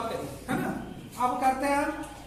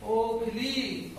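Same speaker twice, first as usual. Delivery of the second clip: ओखली drawn out slowly; correct slow model; 2 semitones lower, 4 dB quieter, much slower than usual. Shown as a demonstration. Teacher correcting a child's ओखली slowly. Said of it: "ओखली, ओ".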